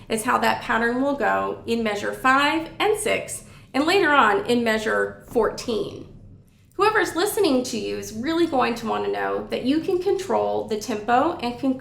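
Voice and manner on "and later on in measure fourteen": usual speaking voice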